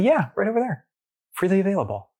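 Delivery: high, cheerful pointing it out; breezy vendor. Retold or imitated. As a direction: toss it off brightly like it's obvious. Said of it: "He said".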